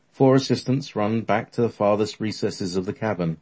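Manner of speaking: plain reading voice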